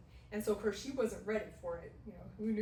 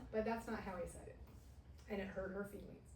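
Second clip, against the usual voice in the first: usual speaking voice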